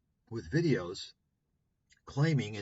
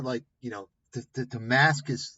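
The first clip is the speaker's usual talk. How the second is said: ordinary delivery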